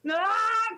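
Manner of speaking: high charging yell; mock war cry on the attack; battle cry. Character charges the enemy.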